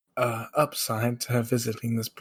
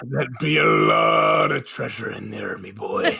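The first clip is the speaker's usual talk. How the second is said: hammed-up pirate growl; 2 semitones lower than usual. Joking pirate line about treasure.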